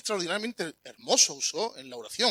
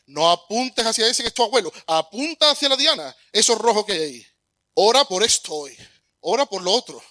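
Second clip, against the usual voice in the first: louder, urgent coach calling corrections; coaching instructor; 3 semitones higher, 7 dB louder than usual. Acted. Preacher voicing the archery instructor correcting his student, loud and urgent. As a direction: steer the archer firmly toward the target.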